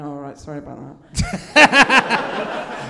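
slow, loud mock apology; retold critic. Confronted Guardian critic apologising, mock climb-down. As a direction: imitate the critic hastily backing down. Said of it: "And he went".

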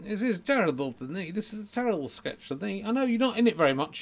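puppet grumble. Pretend. Puppet complains about the terrible sketch, high grumble.

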